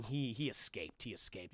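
a hint of shame; mock sheepish. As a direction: confess it sheepishly, trailing off. T'Challa admitting failure.

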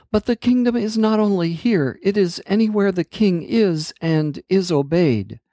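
a lightly voiced earnest girl explaining; gently voiced princess; Amanda's voice. A narrator's reading.

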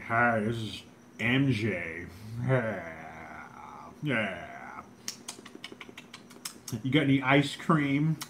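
slow, drawn-out impression voice; badly faked star voice, stretching each word.

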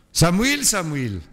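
high call of the name, falling on the repeat; high voiced call of Σαμουήλ. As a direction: call the boy from afar, high then settling. Third call to Samuel.

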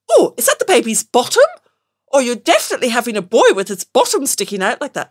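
loud, gushing stranger at the cafe. Retold or imitated. Nosy stranger in a cafe queue coos at her bump, loud and gushing.

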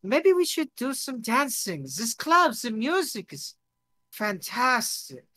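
put-on high, giddy accented enthusiasm; tipsy foreign guest. Drunk guest suggests dancing at the club, giddy and accented.

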